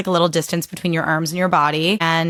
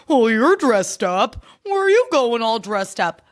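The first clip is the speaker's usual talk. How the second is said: slow, sneering mock of a snide guest; passive-aggressive mock; 3 semitones higher than usual. Mocking party guests who comment on her outfit, passive-aggressive. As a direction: drag out the fake surprise, then the snide question. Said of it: "passive aggressively put me down by going".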